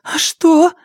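put-on high, nervous girl's question; scared girl, pitched well up; trembling voice. A narrator's reading. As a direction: ask it timidly in a high voice. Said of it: "спросила она дрожащим голосом".

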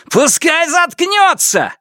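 high, drawn-out furious roar. A narrator's reading. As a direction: roar it out, stretching the words. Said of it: "взревел Сяо Вон". (яростный рёв на высокой ноте)

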